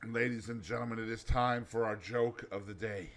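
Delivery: quiet host intro; announcer. Acted. Announces joke of the day.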